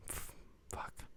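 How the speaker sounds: breathless whispered "fuck"; hushed swear under the breath; whispered curse. Real, not acted.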